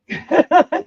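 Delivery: high, loud burst of laughter. Real, not acted. Laughing at the story.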